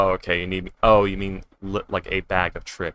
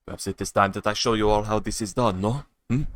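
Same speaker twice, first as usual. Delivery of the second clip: haughty Spanish duelist, pitched up and louder; Spanish accent; 3 semitones higher, 5 dB louder than usual. Acted. Gamer showing the table how it's done, in a Spanish accent. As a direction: swagger it in a hammy Spanish accent. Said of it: "maybe I should avoid the Spanish accent".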